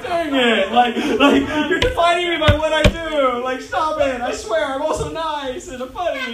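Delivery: high, loud pleading protest; comic exasperation. Retold or imitated. Odd-job worker objecting to being judged, comic exasperation. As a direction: plead your case in hammed-up frustration.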